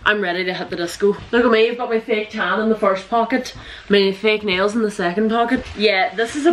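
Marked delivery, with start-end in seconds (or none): none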